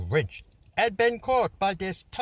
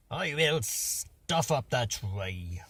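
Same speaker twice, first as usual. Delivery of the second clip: grinning Grinch; 6 dB quieter, slower than usual. Acted. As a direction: drag it out with a hint of scheming Grinch. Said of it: "grinned the Grinch".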